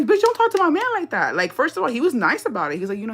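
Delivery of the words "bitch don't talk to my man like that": much higher, far sharper sassy yell at the vendor; sassy clapback. Retold or imitated. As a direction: snap back at the rude vendor for your man, hammed up.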